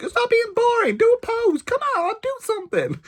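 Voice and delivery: very high, nagging American lady; hammed-up American tourist egging people on; mock American accent. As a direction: imitate a pushy American, squeaky and insistent. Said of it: "She's like".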